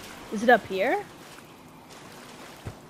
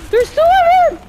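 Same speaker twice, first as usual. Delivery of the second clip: sudden high scared yell, much louder; panicked shriek; 10 dB louder than usual. Real, not acted. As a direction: shriek as the second one appears. Ambushed by two gators in game.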